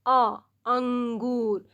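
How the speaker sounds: put-on slowly drawn out "अ, अंगूर" for a child; sounding out a. Shown as a demonstration. Teacher sounding out a word for beginners, slowly. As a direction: stretch the "अ" in "अ, अंगूर" long and clear. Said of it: "पहला वाला हम क्या पढ़ रहे हैं?".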